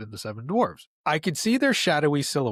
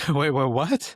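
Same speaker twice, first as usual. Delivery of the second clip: stunned surprise; 3 dB louder than usual. Retold or imitated. Shocked to hear this from mom, disbelieving. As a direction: catch yourself in shock, voice jumping up. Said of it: "I'm like".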